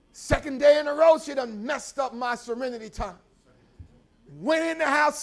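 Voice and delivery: normal talking voice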